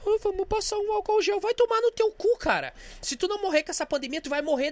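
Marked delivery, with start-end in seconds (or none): 0.1-1.4 mocking quote. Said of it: "o cara". 1.4-2.4 high, louder furious curse; indignant yell, pitched high; angry outburst. Real, not acted. Angry outburst at mocked person.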